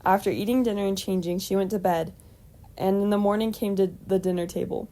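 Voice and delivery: usual speaking voice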